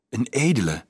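ordinary delivery

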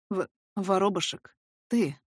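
hoarse halting man's voice; pained stammer. Acted. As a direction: stumble over the words as if throat dry. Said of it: "Слова даются ему с какой-то внутренней болью".

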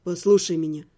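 low, steady and level; calm, even voice. A narrator's reading. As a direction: cut through her panic calmly. Necromancer calms her.

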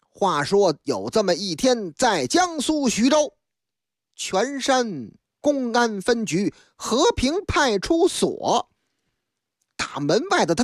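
ordinary talk, nothing marked

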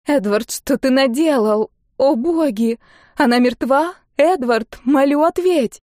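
high, distressed woman's voice; horrified pleading. A narrator's reading. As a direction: beg for an answer in dismay. Woman thinks the child dead. (с ужасом и мольбой)

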